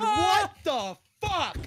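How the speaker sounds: the fish died overnight, slow loud disbelief; outraged disbelief. Retold or imitated. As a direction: stretch it out in shocked outrage. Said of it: "I'm going".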